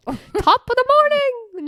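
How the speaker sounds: high, loud, drawn-out bad Irish accent; hammed-up Irish greeting that veers Scottish; attempted Irish accent. Shown as a demonstration. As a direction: call it out in a botched Irish accent. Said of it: "That's more Scottish".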